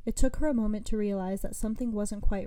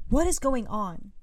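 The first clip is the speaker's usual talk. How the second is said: yelling; 4 semitones higher, 5 dB louder, slower than usual. A narrator's reading. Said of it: "Arya yelled".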